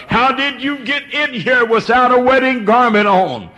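high, loud, thundering voice of judgement; God casting him out. Acted. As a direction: boom out the King's verdict over the guest. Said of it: "this is God Almighty as he cast him away".

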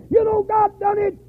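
high, loud, slow proclamation; triumphant shout. Acted. Preacher to congregation, slow triumphant shout. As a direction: shout the victory slowly.

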